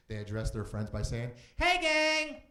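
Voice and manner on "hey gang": high, loud cheery mock greeting with a laugh; mock gang greeting. Comedian mimicking gang members greeting friends, cheery. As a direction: chirp it like a peppy gang hello.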